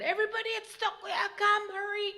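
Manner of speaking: high, loud, fast frightened call; scream. A narrator's reading. Girl screaming to the children, high fast alarm. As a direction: scream for the others to hurry. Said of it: "and she screamed again".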